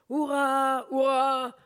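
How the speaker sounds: high, loud yell; howling hurrah. Acted. Thug howling hurrah in the crowd, loud. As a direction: holler it at the top of your lungs. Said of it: "Le voyou hurlant sans cesse".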